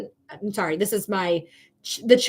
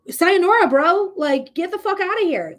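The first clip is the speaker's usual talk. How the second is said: louder, much higher mocking send-off; loud farewell; 7 semitones higher, 4 dB louder than usual. Reader loudly sends the client's ex packing. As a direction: wave him off, loud and done. Dismissing the ex for good.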